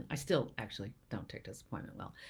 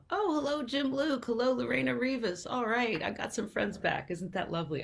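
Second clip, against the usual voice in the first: high, pleased hello to friends; delighted greeting; 9 semitones higher, 3 dB louder than usual. Real, not acted. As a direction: greet returning friends in a much higher voice. Greets arriving audience members by name.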